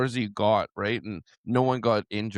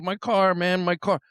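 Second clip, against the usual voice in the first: put-on panicked drunk friend; 7 semitones higher than usual.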